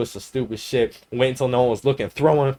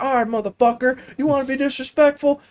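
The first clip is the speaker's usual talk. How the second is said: high-pitched threat, getting louder; heated dad; 11 semitones higher, 3 dB louder than usual. Retold or imitated. Dad threatening his smart-ass son, heated. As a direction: imitate an angry dad building to a yell. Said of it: "he was fucking heated".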